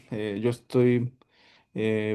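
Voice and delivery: ordinary delivery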